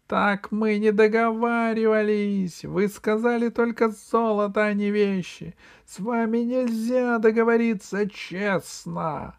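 very high, strained pygmy outcry; indignant yelling. A narrator's reading. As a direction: yell in high-pitched outrage at being cheated. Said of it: "и закричал". (возмущённый высокий крик)

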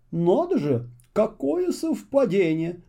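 mild storybook astonishment; exclaiming. A narrator's reading. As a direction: Muffle marvelling at the coincidence. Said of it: "воскликнул муфта".

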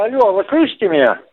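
ordinary delivery

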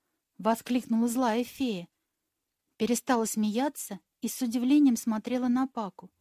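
ordinary delivery, nothing marked

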